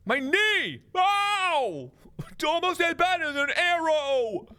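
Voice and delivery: high, yelping mock agony; cartoon yowl of pain; hammed-up pain. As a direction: overplay the hurt knee. Hit by a rock.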